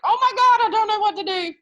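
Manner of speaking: high, loud panicked cry; retold panic. Teacher reliving her ski panic for the class: high, loud frightened cry. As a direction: squeal it like a scared kid on a steep slope. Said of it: "I was like".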